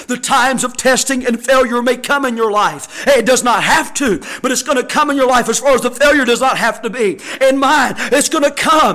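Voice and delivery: preaching that rises to full force; impassioned pulpit build; building sermon. Real, not acted.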